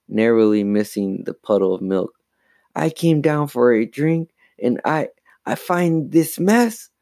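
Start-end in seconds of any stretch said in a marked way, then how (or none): 2.8-6.9 high, upset, wavering account; trembling voice. A narrator's reading. Chris explains the mess to Mom, trembling voice. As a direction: explain haltingly in a raised, distressed pitch. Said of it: "in a trembling voice".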